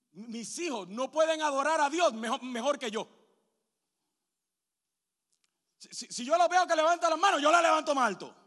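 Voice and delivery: predicando a todo pulmón, más alto y más fuerte; predicación fervorosa. Acted. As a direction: clama al púlpito con fuerza creciente. Pastor preaching to the congregation. (predicación a plena voz)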